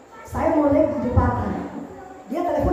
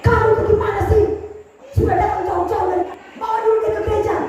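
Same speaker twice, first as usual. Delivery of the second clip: scolding; 7 semitones higher, faster than usual. Retold or imitated. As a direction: scold her like a pastor's wife. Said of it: "Ibu Gembala marah saya".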